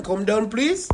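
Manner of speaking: ordinary talk, nothing marked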